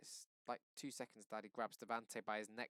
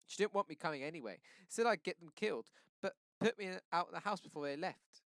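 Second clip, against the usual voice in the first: tearful; 7 semitones higher, 9 dB louder than usual. A narrator's reading. Devante bawling while confessing.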